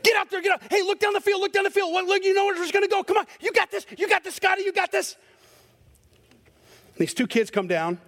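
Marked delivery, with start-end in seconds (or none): 0.0-5.2 father yelling at his son from the sideline; sideline dad yelling. Retold or imitated. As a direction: yell at the goalie from the sideline.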